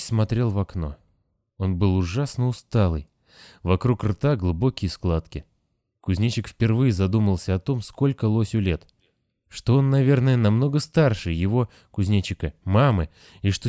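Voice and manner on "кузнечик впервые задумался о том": usual speaking voice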